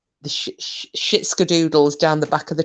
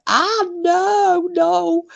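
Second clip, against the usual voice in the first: squeaky, much higher cries of no; mock panic; 5 dB louder, much slower than usual. Retelling being pulled up on panel, high mock panic. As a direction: protest like being dragged up on stage. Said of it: "I was like".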